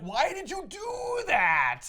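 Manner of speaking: high, drawn-out accusing shout; retold scream. Retelling how he screams at Selina, an anguished shout. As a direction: yell it slowly, stretching the words in outrage. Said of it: "he, like, screams at Selina".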